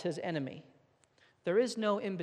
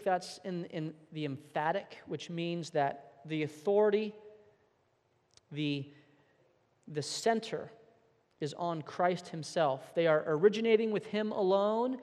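ordinary talk

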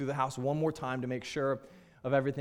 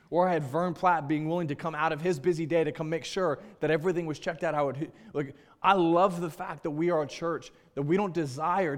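raised, fuller preaching voice to the church; 3 semitones higher, 4 dB louder than usual. Acted. Pastor urging his church to be real friends, fervent preaching. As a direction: drive the message home to the congregation.

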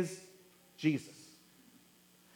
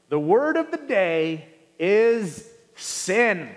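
slow, weighty announcement of the word; announced slowly; 4 dB louder, much slower than usual. Acted. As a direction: lean on it like a big reveal.

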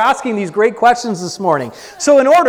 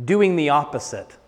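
usual speaking voice

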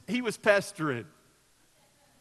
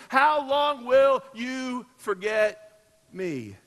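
slow pleading cry, falling off on me; 4 semitones higher, 5 dB louder, much slower than usual. Acted. David crying out to God, slow and pleading. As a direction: plead it, stretching out each word. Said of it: "David cries out this question".

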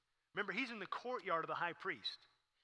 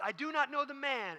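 high, louder, emphatic denial; insistent denial; 9 semitones higher, 5 dB louder than usual. Acted. Peter denies Jesus beside the rooster, high insistent denial. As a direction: protest it in a raised voice. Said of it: "he says those words".

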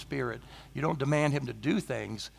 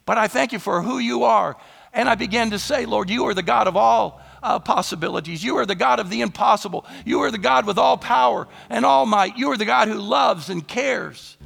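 loud, high, building preacher's proclamation; preaching at full force; 7 semitones higher, 9 dB louder than usual. Acted. Preacher to church, loud fervent proclamation. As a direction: declare God's greatness to the congregation.

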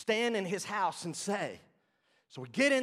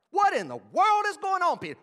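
much higher, loud "What in the world is going on"; full indignant shout; outraged; 10 semitones higher, 8 dB louder than usual. Acted. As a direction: build the accusation to a peak. Repeated accusing question, escalated.

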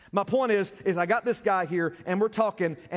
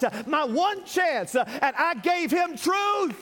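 high, loud, stretched-out preacher's cry; full-force preaching; 11 semitones higher, 5 dB louder, slower than usual. Acted. Pastor defending why he gave him truth, full-force preaching. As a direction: drive every phrase home at the top of the voice.

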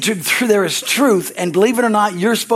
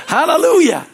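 laughing; 8 semitones higher, 4 dB louder than usual. Real, not acted. Laughing exclamation after the joke.

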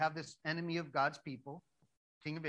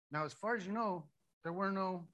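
normal talking voice

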